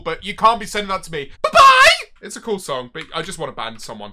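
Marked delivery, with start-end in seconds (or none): 1.4-2.1 high, loud squealed farewell; mock gleeful goodbye. Streamer banning a viewer, gleeful high squeal. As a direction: squeal it out as a send-off.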